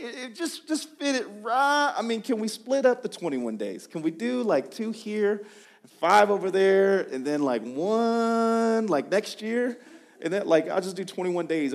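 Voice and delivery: high, pleading haggle with stretched words; hammed-up wheedling for an easier deal; mock bargaining. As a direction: whine out a mock negotiation, drawing out key words. Acts out bargaining over fasting days.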